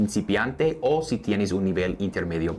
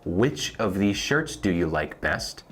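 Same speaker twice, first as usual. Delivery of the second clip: slowed, careful model question; slow teaching read. Shown as a demonstration. Teacher to Spanish learners, slow model question. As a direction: say it slowly so they can follow.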